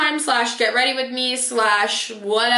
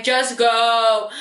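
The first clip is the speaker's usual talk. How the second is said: annoyed dismissal. Retold or imitated. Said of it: "I'm just so annoyed".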